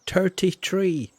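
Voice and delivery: slow Irish accent, "tree" with a plain t; slow mock Irish count; Irish "thirty tree". Shown as a demonstration. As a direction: drawl it out the Irish way, slowly. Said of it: "That's how Irish people say three".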